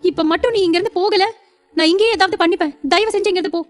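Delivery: high-pitched, desperate threat; frenzied anger. Acted. Wife threatening husband so he leaves, frenzied anger. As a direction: order him out in a high, agitated voice. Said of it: "Shivanya ஆவேசமாக".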